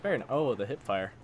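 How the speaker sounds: ordinary talk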